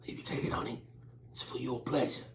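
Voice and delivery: breathy bedroom whisper; hushed mock seduction; seductive whisper. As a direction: whisper it close to her ear.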